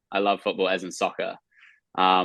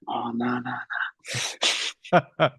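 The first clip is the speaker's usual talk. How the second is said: put-on Kiwi accent; 4 semitones higher, 3 dB quieter, slower than usual. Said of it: "I'm a classic Kiwi. I'm way too like".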